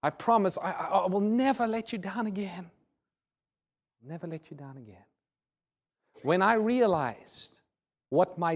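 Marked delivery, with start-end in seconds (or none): 0.0-2.7 higher, stumbling, earnest plea; pleading promise. Retold or imitated. Weeping son pleads with father. 4.0-5.0 very soft, falling echo of a vow; subdued, remorseful promise; hushed promise. Retold or imitated. Promise repeated softly after weeping.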